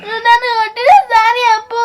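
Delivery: high, loud tearful demand; crying. Acted. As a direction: demand an apology through sobs. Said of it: "ఏడుపు ఆపే". (ఏడుస్తూ గట్టిగా demand)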